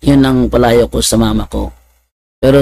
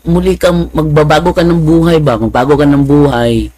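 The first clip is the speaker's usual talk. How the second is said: normal talking voice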